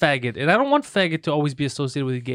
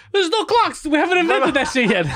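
high, laughing mock outburst; mock exasperation; 12 semitones higher, 3 dB louder than usual. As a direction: protest in a high, incredulous voice. Acting out a clockless worker.

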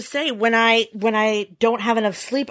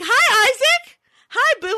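very high, sing-song hello, a bit louder; mommy greeting child; 5 dB louder than usual. Retold or imitated. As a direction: greet your little one in a high coo. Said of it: "I'm like".

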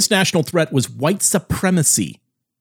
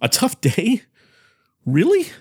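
rising disbelief, much higher on really; can't believe what you heard; incredulous; 7 semitones higher than usual. Retold or imitated. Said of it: "thought to themselves".

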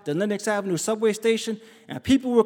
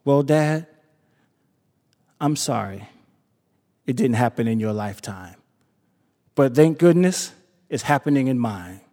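gentle, moved address; tender words to dad; 8 semitones lower than usual. Retold or imitated. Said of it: "And I said".

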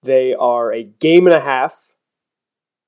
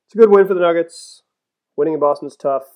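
normal talking voice

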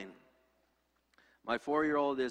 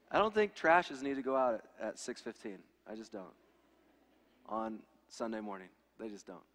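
ordinary talk

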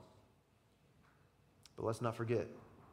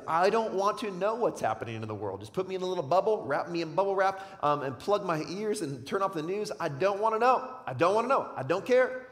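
raised, insistent mock plea to be left alone; voicing a head-in-the-sand believer; 6 semitones higher, 6 dB louder than usual. Preacher voicing a believer who tunes out, mock whiny insistence. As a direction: play someone plugging their ears. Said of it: "in the middle is".

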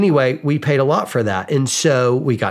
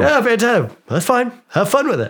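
high, bright sarcastic send-off, much higher than his usual voice; sarcastic cheer; 10 semitones higher than usual. Retold or imitated. Husband telling wife to enjoy the other room, high mock-cheery sarcasm. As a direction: cheerfully wave her off to the other TV.

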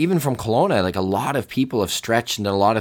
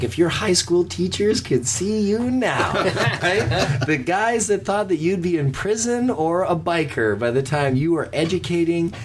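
laughing friend; 9 semitones higher than usual. Retold or imitated. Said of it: "He's like".

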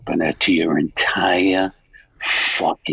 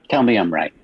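normal talking voice

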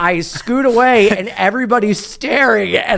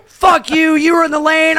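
high, indignant driver; raised, high-pitched mock fury; retold shouting back; 9 semitones higher than usual. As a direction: imitate an irate driver arguing.

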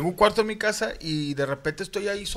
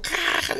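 unvoiced mock noise; 11 semitones higher than usual. Imitating a TikTok video sound.

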